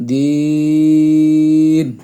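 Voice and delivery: "দিন" with a very long held vowel before the n; tarikan tiga alif. Shown as a demonstration. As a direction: draw "দিন" right out on a level note. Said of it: "তিন আলিফ".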